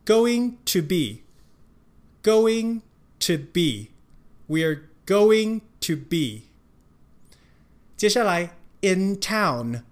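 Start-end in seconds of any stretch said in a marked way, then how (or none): 0.0-6.4 going to be 慢慢连读，to 念得很短; 慢速示范 going to be 连在一起讲; slow linked demo. Shown as a demonstration. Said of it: "所以to的发音更短". (老师慢速示范连读)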